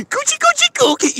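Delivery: high squeaky taunting coo; teasing baby talk. Pretend.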